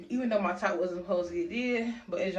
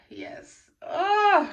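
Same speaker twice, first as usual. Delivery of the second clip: frustrated groan; 12 semitones higher, 9 dB louder than usual. Real, not acted. Frustrated at numbness, cries out.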